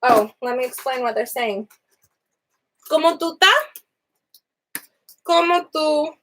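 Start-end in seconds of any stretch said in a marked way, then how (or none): none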